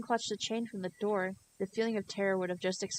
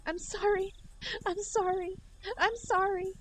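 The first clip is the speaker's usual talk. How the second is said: much higher, frantic sorries; frantic apology; 12 semitones higher than usual. Acted. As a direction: plead in a high panicked voice. Rena pleading.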